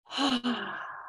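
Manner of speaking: long breathy sigh on the exhale; audible sigh. Shown as a demonstration. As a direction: let the breath out with a big sigh. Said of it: "it's okay to sigh". (releasing sigh)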